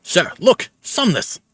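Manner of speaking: soldier's sharp high report; urgent alert. Acted. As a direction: call out a discovery. Soldier alerts his officer.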